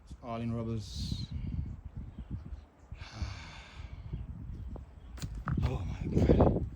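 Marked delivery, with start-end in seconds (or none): 2.9-5.6 long breathy exhale of despair; real heavy sigh; long grieving sigh. Real, not acted. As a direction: let the whole chest empty out. Grief over ruined trucks.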